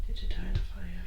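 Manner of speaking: real whisper, soft and breathy; hushed whisper to the spirit. Real, not acted. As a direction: whisper the question into the dark room. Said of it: "I've been really whispering".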